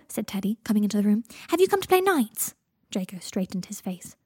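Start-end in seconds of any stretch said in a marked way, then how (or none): 1.5-2.6 put-on high, loud little-kid voice; eager child. A narrator's reading. Teddy asks Draco to play, in an eager child's voice. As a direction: ask it like a hopeful small boy.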